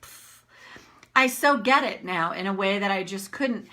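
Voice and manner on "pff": breathy whispered scoff; mock eye-roll whisper; dismissive whatever. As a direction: brush it off with a whispered pff. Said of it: "I was like".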